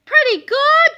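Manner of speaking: high, loud protesting outburst; indignant cry. A narrator's reading. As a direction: cry out offended at the teasing. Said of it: "cried old Harold".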